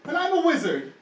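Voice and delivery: very high, declaiming creature voice; high, loud, self-important animal character; proud talking sheep. Acted. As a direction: introduce yourself grandly as a sheep wizard. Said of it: "the sheep that's sitting on the ground".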